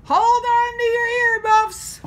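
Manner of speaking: high, loud excited call. Acted. Driver Annie calls to the kids before the hill, loud excited shout. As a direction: whoop it out to the kids. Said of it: "she called out".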